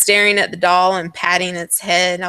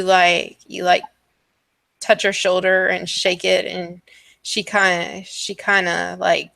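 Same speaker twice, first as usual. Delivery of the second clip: ordinary talk, nothing marked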